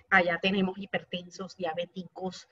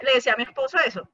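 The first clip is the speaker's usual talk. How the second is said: normal talking voice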